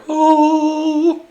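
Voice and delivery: high, held angelic "ooh"; imitated heavenly "oh". Jesus statue opening his arms, high held note. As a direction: sustain one high note like a heavenly choir. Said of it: "opens his arms like".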